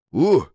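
sudden loud, high startled yelp; frightened shout. A narrator's reading. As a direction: jump back with a scared cry. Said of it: "schrie er".

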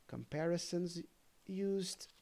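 ordinary delivery

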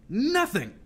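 high, loud, angry cry; furious outburst. A narrator's reading. Captain disowning the girl, exploding in anger. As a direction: burst out with it, pitch leaping.

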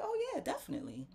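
high eager agreement; eager yes. Retold or imitated. Vlogger agreeing to sell to the shopper, high and eager. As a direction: jump at the chance, pitched high. Retelling own eager reply.

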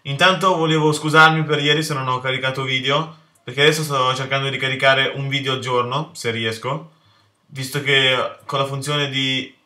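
normal talking voice

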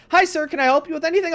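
high, fast, bright shop-clerk greeting; chirpy store clerk. Retold or imitated. Startled customer mimics clerk greeting him in a high, fast voice.

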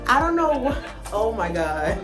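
ordinary delivery

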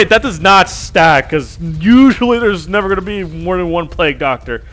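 usual speaking voice

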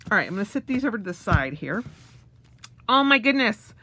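ordinary talk

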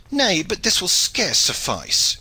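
high, drawn-out protest; crying out. A narrator's reading. Shipman objects to the knight's advice, crying out. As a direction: cry it out in objection. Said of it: "cried the shipman".

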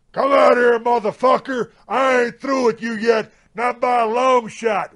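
high, strained mock shout; fighting challenge. Horsefly outside the trailer door challenging the man, high angry shout.

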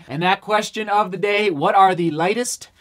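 ordinary talk, nothing marked

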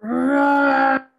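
loud, high drawn-out mock roar; dragon roar. Reader voicing a dragon in battle, roaring loudly.

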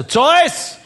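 high, loud yell for someone; shouted call. Retold or imitated. Visitor at the castle calls for Zeus, shouting. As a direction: call out a name at full volume. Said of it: "Ich schreie also".

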